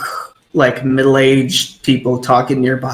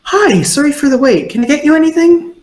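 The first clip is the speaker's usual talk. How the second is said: much higher, weary-polite innkeeper voice; put-upon innkeeper; 12 semitones higher than usual. Acted. Innkeeper apologizing for the wait, high and put-upon. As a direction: sound overworked but polite. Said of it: "slightly put upon sounding innkeeper".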